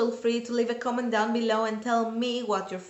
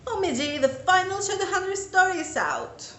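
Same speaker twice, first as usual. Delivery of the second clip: excited; 8 semitones higher, 3 dB louder than usual. Retold or imitated. Reenacting her excited reading reaction.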